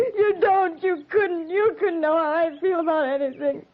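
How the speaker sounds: high, tear-choked protest; tearful outburst. Acted. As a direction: cry it out at him. Grieving widow rebukes captain.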